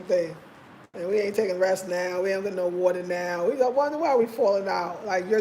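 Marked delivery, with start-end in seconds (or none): none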